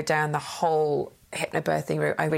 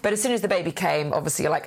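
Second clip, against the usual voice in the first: normal talking voice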